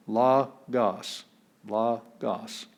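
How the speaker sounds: logos spoken as LAH-gahs, falling; slow careful logos; two-syllable logos; Greek logos. Shown as a demonstration. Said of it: "So we have logos".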